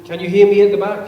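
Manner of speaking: quick loud check that the back row can hear; calling to the back. Real, not acted. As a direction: project it out to the far seats. Speaker checks audience can hear.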